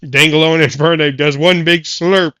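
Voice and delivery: announcer voice; referee voice. Acted. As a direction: call the match like a referee. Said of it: "get out of the referee voice".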